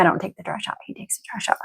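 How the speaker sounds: a real whispered aside. Real, not acted. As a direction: drop to a breathy whisper, confiding. Whispered aside about husband.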